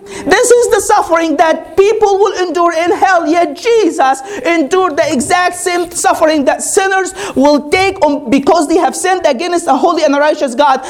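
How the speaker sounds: usual speaking voice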